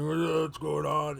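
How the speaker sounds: loud, slow orc voice. Acted. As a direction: drag it out like a big orc. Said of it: "so the orc, he's like".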